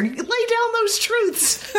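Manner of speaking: slow, high, drawn-out proclamation; dramatic cheer. Retold or imitated. Host reading her notes aloud to cohost, slow high mock proclamation. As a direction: savor each word like a battle cry. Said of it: "And my notes here".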